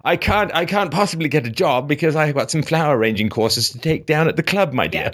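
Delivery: mock posh lady of leisure, high; imitating a ladies-who-lunch lockjaw; lockjaw accent. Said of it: "the stereotypical ladies who lunch".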